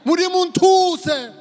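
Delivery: loud, slow cry for help; desperate plea. Retold or imitated. Preacher voicing Jesus' possible plea, loud slow desperate cry. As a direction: plead to God at full voice. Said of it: "or he could have said".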